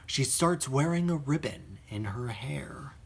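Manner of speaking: a creepy, hushed whisper; spooky hushed aside; eerie whisper. Acted. Creepy plot twist in review.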